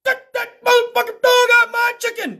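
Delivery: high, loud yell of outrage; acted angry yell. Passenger yelling at a police dog in his chicken, outraged. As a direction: holler at the dog in mock fury. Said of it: "Stinger yelled at that motherfucking dog so fucking loud".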